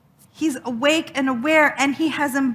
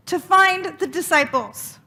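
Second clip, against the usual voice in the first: ordinary talk, nothing marked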